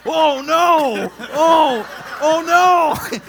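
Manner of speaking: high, very loud squealing panic; mock panic. Dad spotting the cop behind the truck, hammed-up panicked squeal. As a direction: shriek it like a caught dad.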